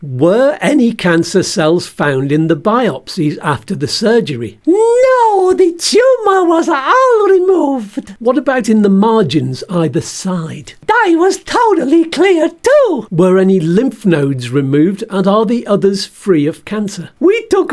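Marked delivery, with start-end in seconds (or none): 4.7-8.2 very high, slow squeaky puppet voice playing the surgeon; cheeky puppet surgeon with a much higher pitch; Bert the stoma bag as the surgeon. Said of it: "can you be the surgeon for me". 10.8-13.1 cheeky puppet surgeon with a much higher pitch; Bert the stoma bag as the surgeon. Pretend. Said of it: "can you be the surgeon for me".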